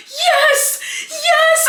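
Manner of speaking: high, loud, laughing yell; overjoyed cheering. Retold or imitated. Host relives her delight at dodging the miscommunication trope, cheering. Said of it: "I was so happy".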